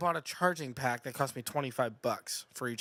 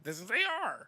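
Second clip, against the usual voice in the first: mock claim; 6 semitones higher than usual. Mocking the other's claim.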